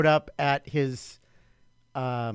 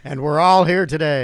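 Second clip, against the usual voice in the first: booming; 8 dB louder than usual. Acted. Guest echoes in loud voice.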